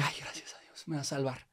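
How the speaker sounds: breathless whispered thanks under the breath; relieved whisper. Retold or imitated. Narrator whispering her relief at the stranger's arrival. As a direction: whisper it like a private prayer of relief.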